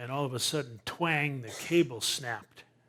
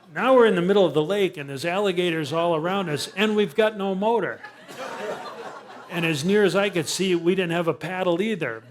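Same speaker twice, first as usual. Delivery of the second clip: excited laughing; 5 semitones higher, 8 dB louder than usual. Real, not acted. Stranded among alligators, laughing.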